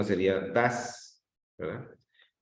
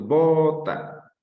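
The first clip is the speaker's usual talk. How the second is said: BOta, with "bo" drawn out loud and higher; stressed first syllable; 6 semitones higher, 5 dB louder than usual. Shown as a demonstration. Teacher showing the class where "bota" is stressed, stressed first syllable. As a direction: stretch and lift the "bo" of "bota".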